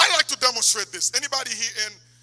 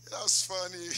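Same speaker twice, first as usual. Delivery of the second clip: ordinary talk, nothing marked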